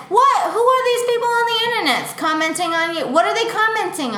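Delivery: put-on ready to fight for her girl; protective outrage.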